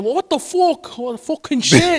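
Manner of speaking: put-on high Irish-accented swearing; hammed-up Irish exclamation; mock Irish. Imitating how Irish people swear, mock Irish accent. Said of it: "I love saying".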